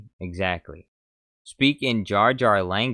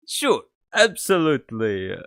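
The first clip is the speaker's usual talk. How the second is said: higher, louder alien trader; Watto voice; 6 semitones higher, 7 dB louder than usual. Acted. Streamer echoing the game clip in Watto's voice. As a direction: do the junk dealer's reply.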